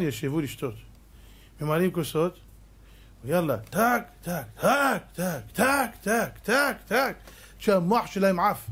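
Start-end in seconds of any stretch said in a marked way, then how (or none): 3.2-7.2 clinking glasses. Retold or imitated. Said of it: "imitating glasses clinking".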